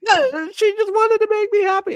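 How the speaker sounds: very high, loud, hammed-up whine; mock wail. Man retelling the punchline, hammed-up tearful wail.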